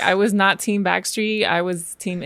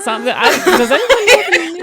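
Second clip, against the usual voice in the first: laughing; 9 semitones higher, 6 dB louder, faster than usual. Real, not acted. Laughing while asking.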